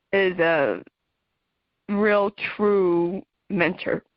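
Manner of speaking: normal talking voice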